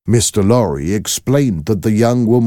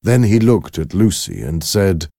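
plain reading voice

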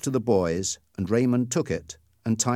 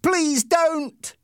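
high, loud desperate cry; screamed plea; 5 dB louder than usual. Acted. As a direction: scream to stop the gun. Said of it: "screamed Peter".

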